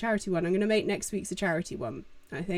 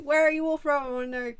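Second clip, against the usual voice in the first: higher-pitched, excited mock greeting; eager self-imitation; 5 semitones higher, faster than usual. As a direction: mimic your own eager stream opener. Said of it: "I'm like".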